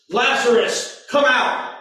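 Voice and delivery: a pastor voicing Jesus calling Lazarus from the tomb, loud and direct. A narrator's reading. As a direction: call it out loud and high. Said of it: "called out in a loud voice".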